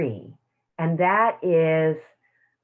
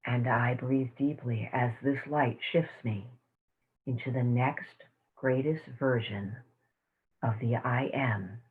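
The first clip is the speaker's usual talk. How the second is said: guided meditation; 5 semitones lower, 5 dB quieter than usual. Acted. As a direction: lead the room gently into calm. Closing a guided tapping meditation.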